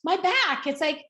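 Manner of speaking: high, exasperated mock snap at her daughter; irritated mother snapping at a daughter who keeps offering a back rub; retold snap. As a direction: act out snapping at your daughter, fed up. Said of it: "I kinda snapped at her".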